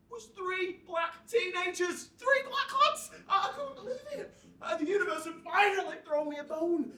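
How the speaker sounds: high, loud burst of delight; thrilled outburst, nearly laughing in disbelief; overexcited. Acted. Overjoyed narrator spots potential friends.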